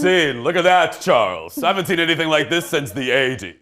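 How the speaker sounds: a hint of a snooty rich-suburb accent, a bit quick; mock posh accent. Rich Edina resident telling Charles to look, light snooty accent. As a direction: rattle it off like a snooty local lady. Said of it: "an accent like that".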